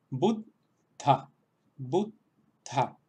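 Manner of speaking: "Buddha" with a clear aspirated dh; sanskrit word. Shown as a demonstration. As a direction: say "Buddha" with the breathy dh. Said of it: "las voy a repetir dos veces". ("Buddha" con la dh aspirada)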